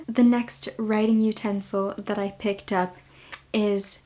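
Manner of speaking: usual speaking voice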